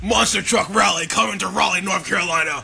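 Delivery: booming announcer hype voice; loud radio ad promo; monster truck ad. Pretend.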